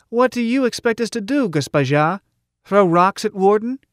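high-pitched, querulous challenge; peevish heckler. A narrator's reading. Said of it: "the peevish voice said".

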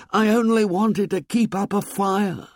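very high, urgent plea; urgent whisper. A narrator's reading. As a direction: plead urgently, pitch pushed right up. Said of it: "whispered Ralph urgently".

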